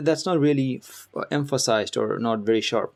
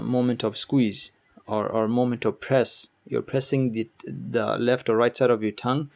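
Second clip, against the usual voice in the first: normal talking voice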